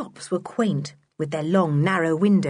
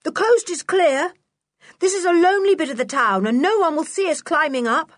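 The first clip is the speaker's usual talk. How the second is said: high-pitched, urgent call; 10 semitones higher, 4 dB louder, faster than usual. A narrator's reading. Sooty telling the others the coast is clear, calling. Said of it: "called Sooty".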